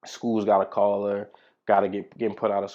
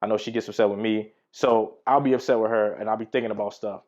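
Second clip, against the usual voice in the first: ordinary delivery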